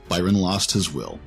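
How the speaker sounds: ordinary delivery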